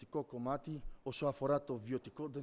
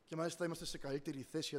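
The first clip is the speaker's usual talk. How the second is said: ordinary talk, nothing marked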